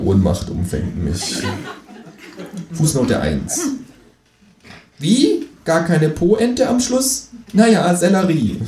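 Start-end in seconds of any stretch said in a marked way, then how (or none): 5.0-8.6 kangaroo voice. Acted. Kangaroo footnote voice.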